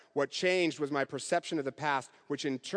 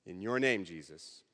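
softer, lower prayer voice; closing prayer; 4 semitones lower, 4 dB quieter than usual. Acted. Preacher leads the congregation in a closing prayer, softly. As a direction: pray it softly, reverently.

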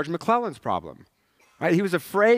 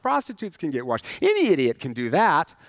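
ordinary talk

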